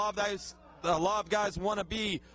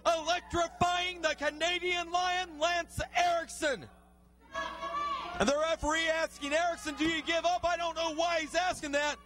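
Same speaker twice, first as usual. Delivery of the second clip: higher, excited ringside call; excited play-by-play; 6 semitones higher than usual. Acted. Commentator calling Billy Litt's comeback for the fans, excited and higher. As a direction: ride the comeback, voice lifted and urgent.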